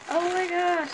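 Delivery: amazed gasp. Real, not acted. Reacts to the opened package.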